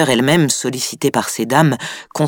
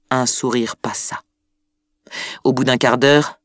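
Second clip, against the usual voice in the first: ordinary delivery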